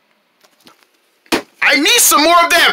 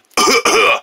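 dry cough; 3 semitones higher than usual. Acted. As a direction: let out a cough. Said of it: "I'm already coughing".

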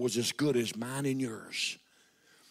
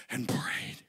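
breathy whispered prayed; closing the list of prayers in a whisper; 4 semitones lower, 5 dB quieter than usual. Acted. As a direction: let the last prayed fall to a whisper. Whispered end of repetition.

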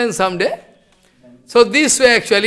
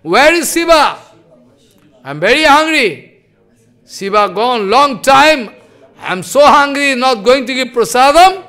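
louder, higher, impatient grumbling; angry hungry complaint; 5 semitones higher, 5 dB louder than usual. Acted. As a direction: complain loudly that you're starving. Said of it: "showing angry attitude".

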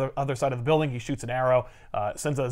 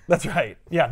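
ordinary delivery

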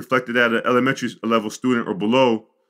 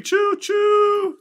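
train whistle imitation. Imitating the little train that kept going, high choo-choo. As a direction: toot it out like a little train engine. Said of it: "like, little train".

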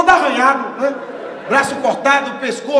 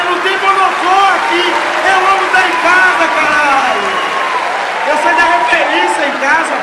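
panicked outburst; 5 semitones higher, 9 dB louder than usual. Retold or imitated. Quoting own panicked outburst.

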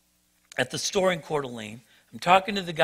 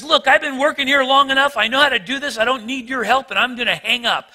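higher, louder snippy brush-off; attitude; 7 semitones higher, 5 dB louder than usual. Retold or imitated. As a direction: snap back like an irritated clerk. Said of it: "gets an attitude with the guy".

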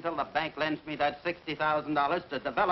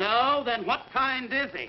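loud, high, slow angry challenge; father angrily mocking his wife's defense of Alvin; angry retort; 7 semitones higher, 5 dB louder than usual. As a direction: throw the question back in anger.

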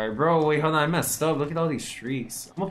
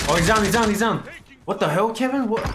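excited shout; 8 semitones higher, 5 dB louder than usual. Real, not acted. Enemy killed in match.

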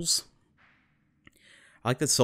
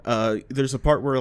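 usual speaking voice

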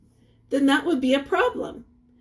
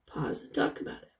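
whisper; 11 dB quieter, faster than usual. Real, not acted. Teacher prompting class discussion.

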